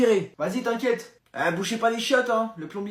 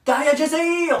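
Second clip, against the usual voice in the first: higher, loud accusing shout; 6 semitones higher, 6 dB louder, slower than usual. Acted. Parent shouting at the caught son, high and loud. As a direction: shout him down.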